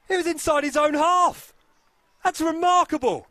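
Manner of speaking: high, loud, amazed; excited. Real, not acted. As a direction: still roaring with disbelief. Commentator calls a long-range goal.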